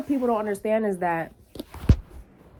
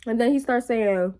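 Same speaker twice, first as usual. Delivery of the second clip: ordinary talk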